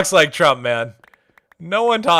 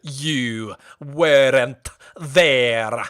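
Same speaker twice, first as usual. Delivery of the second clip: very slow, brooding sea captain; Davy Jones impression; 3 semitones lower, much slower than usual. Doing Davy Jones for chat, very slow brooding pirate. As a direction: stretch every word with menace. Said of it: "I had a Davy Jones impression".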